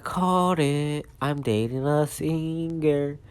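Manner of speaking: high, loud singsong boast; acted gleeful gotcha; triumphant glee. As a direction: crow it, stretching the last word. Jiro triumphant at Izuku's confession.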